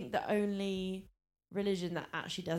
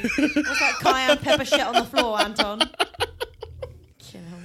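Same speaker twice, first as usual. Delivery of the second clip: laughing tease; 6 semitones higher, 11 dB louder, much slower than usual. Real, not acted. Teasing co-host about drugs.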